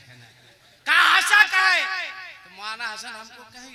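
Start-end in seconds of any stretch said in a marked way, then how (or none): 0.9-1.8 mock scolding mother. Mother scolding her laughing son at the wedding, mock-angry. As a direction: snap at the giggling groom as his mother. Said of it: "मुझको बोली".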